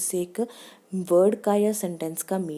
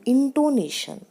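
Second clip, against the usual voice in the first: INTONATION said louder, higher and drawn out; emphatic heading; 5 semitones higher, 4 dB louder, slower than usual. Shown as a demonstration. Teacher demonstrating how she says a heading, emphatic. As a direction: announce intonation like a heading, with extra force. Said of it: "ज़्यादा ज़ोर दे के बोलती".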